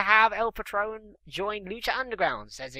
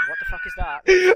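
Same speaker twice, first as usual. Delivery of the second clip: much higher cartoonish shriek; high squeaky mock voice; 8 dB louder than usual. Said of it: "he's like".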